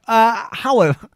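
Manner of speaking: normal talking voice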